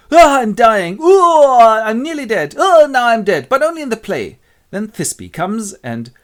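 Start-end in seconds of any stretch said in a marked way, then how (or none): 0.1-4.4 high, very loud death wails; hammy dying. Acted. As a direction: die noisily like a bad actor. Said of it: "dies for some minutes".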